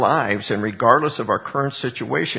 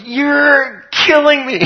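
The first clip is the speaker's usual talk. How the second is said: much higher, louder cry broken by laughter; laughing mock wail of a little boy; mock scream; 14 semitones higher, 8 dB louder, slower than usual. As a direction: imitate the screaming kid while laughing. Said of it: "and he goes".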